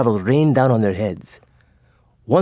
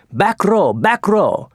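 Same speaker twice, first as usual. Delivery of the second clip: shouted order; 8 semitones higher, slower than usual. A narrator's reading. Admiral commanding oarsmen to back water, urgent shout. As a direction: bark it to the rowers.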